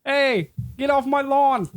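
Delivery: high, drawn-out get-off-my-lawn yell; crotchety lawn owner. Pretend. Co-host butting into the deli accent bit, crotchety. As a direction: play a grouch chasing someone off.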